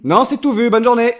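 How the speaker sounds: high, loud brush-off; shouting a curt goodbye; loud refusal. Acted. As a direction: call out no thanks through the door. Refusing visitor through shutter.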